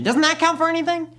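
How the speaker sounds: high, indignant appeal; exasperated plea. Acted. Rejected applicant pleading his credentials to interviewer, exasperated. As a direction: plead it, voice rising high in frustration.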